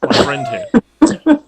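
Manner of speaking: very high, slow goblin squeak. Acted. Goblin presents the troll.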